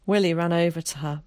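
plain reading voice

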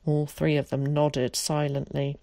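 plain reading voice

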